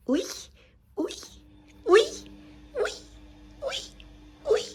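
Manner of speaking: short high "uy" squeaks, spaced out; repeated high playful calls; teasing little noises. Real, not acted. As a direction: tease a pet with tiny yips.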